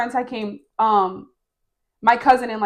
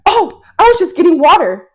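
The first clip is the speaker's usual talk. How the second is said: loud, high startled excuse; caught-out excuse; 7 semitones higher, 8 dB louder than usual. Retold or imitated. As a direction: act caught red-handed, blurting an alibi. Caught at knife drawer, feigned excuse.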